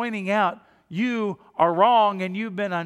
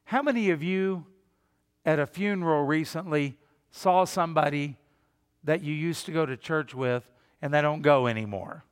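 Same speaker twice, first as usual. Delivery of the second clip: ordinary talk, nothing marked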